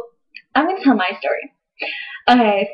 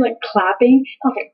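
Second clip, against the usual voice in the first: ordinary talk, nothing marked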